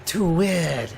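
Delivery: hammed-up outrageous French accent; co-host echoing the intro in a mock French accent; fake French accent. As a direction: ham up a jokey French accent. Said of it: "this is my usual accent".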